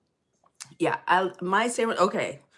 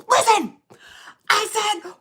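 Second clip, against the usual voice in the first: loud, high, furious "Listen!"; yelling at the sandwich guy in a retold fight; angry shout; 14 semitones higher, 8 dB louder than usual. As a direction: snap at him at full volume. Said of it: "I lost my entire shit on him".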